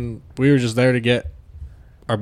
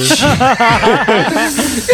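high, loud burst of laughter mid-story; 11 semitones higher, 6 dB louder than usual. Real, not acted. Storyteller cracking up before the punchline, bursting into laughter.

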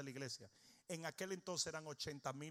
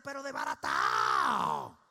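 much lower, loud mocking growl; mock prayer shout; 11 dB louder, slower than usual. Pastor mocking a critic's prayer for poverty, deep mock shout. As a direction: bellow the last word of the fake prayer. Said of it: "pero tú nunca lo oyes orando así".

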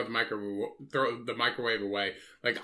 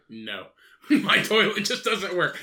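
higher, louder quote broken by laughter; laughing quote; 6 semitones higher, 5 dB louder, slower than usual. Retold or imitated. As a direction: imitate her excuse while cracking up, drawn out. Retelling absurd excuse, laughing.

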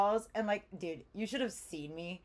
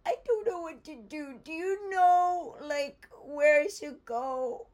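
slow, high, tearful begging; tearful plea; 7 semitones higher, 5 dB louder, slower than usual. Retold or imitated. Asking the pilot where to go, slow tearful plea. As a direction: plead slowly through tears.

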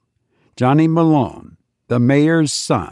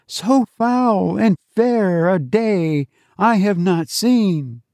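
put-on higher, children's chorus; the class repeating the teacher's line, higher; children reciting in chorus; 8 semitones higher, slower than usual. A narrator's reading. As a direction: lift it like a class reciting together. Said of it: "The children's voices lifted".

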